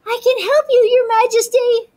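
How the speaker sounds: high, loud squeaky mouse cry; eager little mouse. A narrator's reading. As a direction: call out bravely like a tiny mouse. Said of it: "the little mouse cried".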